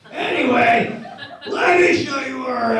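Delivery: giddy laughing yell; laughing shout. Acted. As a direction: shout it while cracking up. Laughing shout while changing subject.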